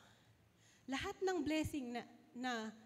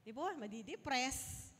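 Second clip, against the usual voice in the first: usual speaking voice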